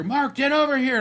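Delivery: high, loud urgent call across the room; calling out urgently. Retold or imitated. Rocky calls Mark across the hall, urgent shout. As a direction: yell for him to come now.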